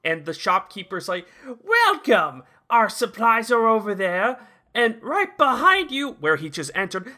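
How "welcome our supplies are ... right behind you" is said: cheery shopkeeper. Retold or imitated. Retold shopkeeper greeting Fox.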